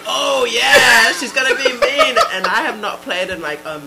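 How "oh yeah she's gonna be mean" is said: laughing imitation.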